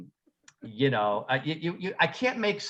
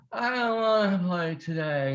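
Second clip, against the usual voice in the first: put-on whiny diva girl; 2 semitones higher than usual.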